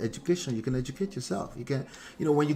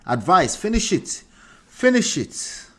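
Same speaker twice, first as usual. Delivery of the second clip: urging emphatically; 6 semitones higher, 7 dB louder than usual. Real, not acted. Urging advice giver to finish.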